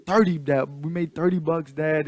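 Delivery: put-on loud, high boy bragging to Dad; imitating excited kid. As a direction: announce it proudly like a kid. Retold boy boasts to dad by phone.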